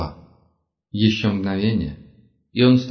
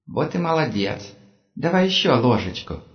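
put-on child voice; 4 semitones higher than usual. Giant boy feeding Anatoly.

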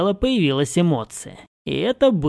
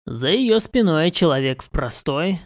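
ordinary delivery

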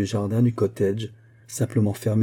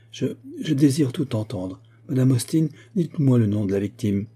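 ordinary delivery

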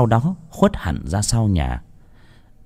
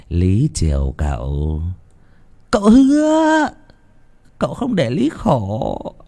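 mocking imitation; 2 semitones higher than usual. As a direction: taunt her with fake sweetness. Mocks her, voicing her. (giễu cợt, nhại giọng nũng nịu)

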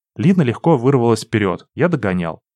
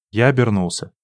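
plain reading voice

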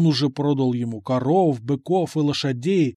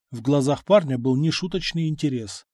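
ordinary delivery, nothing marked

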